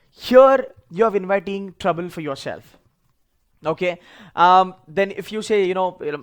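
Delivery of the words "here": sudden loud high burst; loud exclamation. Real, not acted. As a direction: snap it out loudly.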